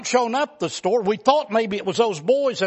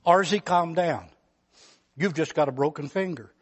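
calm doctor; 4 semitones lower, 4 dB quieter than usual. Retold or imitated.

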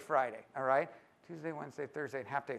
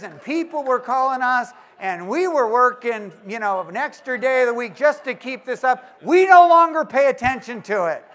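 higher and louder, amused; mock email read; 7 semitones higher, 13 dB louder than usual. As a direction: read it like the cheerful pie man. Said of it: "this is what it says".

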